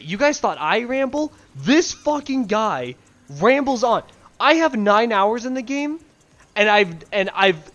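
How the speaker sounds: high, loud exasperation; exasperated rant. Real, not acted. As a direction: rant at the narrator, fed up. Exasperated rant about narrator.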